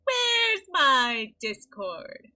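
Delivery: very slow, loud, dragged out; loud slow drawn-out dismay; dismayed wail. Real, not acted. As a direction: wail it in disbelief. Discord suddenly crashed.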